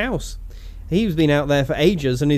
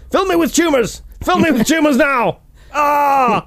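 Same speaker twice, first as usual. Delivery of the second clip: high, loud, hammed-up ranting comic; mock rant; 13 semitones higher, 5 dB louder, slower than usual. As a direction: shriek it like a comedian begging for poison. Mocking Bill Hicks ranting.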